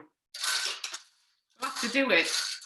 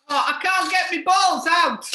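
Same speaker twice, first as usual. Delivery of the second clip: exasperated loud cry, pitched up; frustrated shout; 6 semitones higher, 5 dB louder than usual. Real, not acted. Struggling with the bingo machine.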